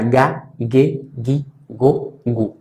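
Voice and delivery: "ga", "ge", "gi", "go", "gu" each with a hard G as in go; German hard G demo; hard G syllables. Shown as a demonstration. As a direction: spell out "ga ge gi go gu" with a hard G every time. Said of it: "Así va en alemán".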